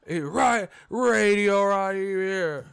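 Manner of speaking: cranky promoter cutting off the interviewer; grumpy Bob Arum. Retold or imitated. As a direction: play him irritated before the question even comes. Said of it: "can Radio Raheem get his, uh, intro out".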